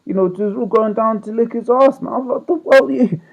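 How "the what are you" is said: retold disbelief. Shocked at the explicit video.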